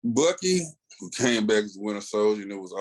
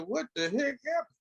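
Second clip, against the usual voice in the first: much higher, fast incredulous question; amazed; 4 dB quieter, faster than usual. Retold or imitated. Reader hooked by the mystery, high and incredulous. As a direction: burst out in disbelief.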